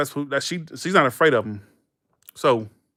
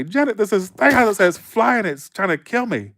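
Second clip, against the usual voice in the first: a retold four-year-old's panic, pitched higher; panicked child; 7 semitones higher than usual. Little kid running to the babysitter, mildly panicked. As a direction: blurt it out like a scared little kid. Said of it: "I freak the fuck out".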